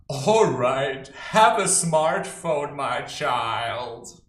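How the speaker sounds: loud, slow giving-in voice; mock indulgent parent. Pushover parent granting a smartphone, loud and slow. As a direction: hand it over grandly like a pushover parent. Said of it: "you as a parent say".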